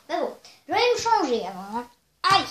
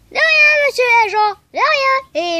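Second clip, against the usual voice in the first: chirpy thanks; 9 semitones higher, 16 dB louder than usual. Acted. Jamie answers the policeman.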